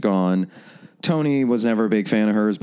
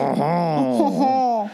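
high mock French "Oh"; hammy French exclamation; French chef; 11 semitones higher than usual. French bit begins.

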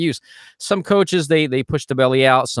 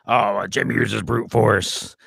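slow, lightly put-on mock voice; a slight parody of a critic; slower than usual. Teacher mocking a critic's complaint, lightly put-on voice. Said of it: "I did that voice for Claude Delaroche".

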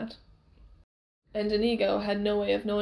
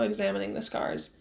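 ordinary delivery

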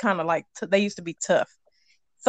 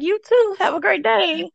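high, sing-song fake politeness; overly cheerful comeback, pitched up; mock cheerful; 9 semitones higher than usual. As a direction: chirp it back sweetly. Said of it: "And I'm like".

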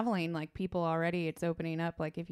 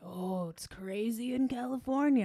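imitating worried family; 4 semitones higher, 4 dB louder than usual. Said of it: "they were like".

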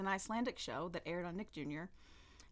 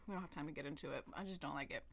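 whispering; 5 semitones lower, 9 dB quieter, faster than usual. Real, not acted. Whispered aside, unvoiced.

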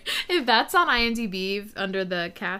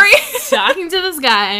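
laughing; 9 semitones higher, 12 dB louder than usual. Real, not acted. Resuming story, still laughing.

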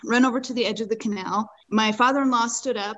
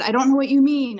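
louder, higher protest of not understanding; retold confusion; 4 semitones higher, 6 dB louder than usual. Father-in-law answering his panicked guide, retold confusion. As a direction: imitate someone baffled by shouted orders.

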